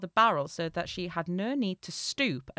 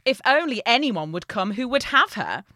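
louder, emphatic storybook mother; a touch of motherly exasperation, pushed louder; mother's voice; 3 semitones higher, 7 dB louder than usual. A narrator's reading. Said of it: "said the mother".

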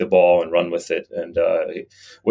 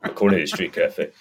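laughing while talking; 3 semitones lower than usual. Real, not acted. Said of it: "I need to deepen this voice".

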